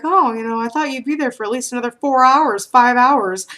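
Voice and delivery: surprised mother. Retold or imitated. Mother surprised at early call.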